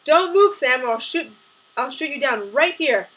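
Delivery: loud barked order, high at the start; barked threat. A narrator's reading. Gunman threatening to shoot Sam, barking. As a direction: bark it as a threat, forceful to the end. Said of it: "The shadow barked".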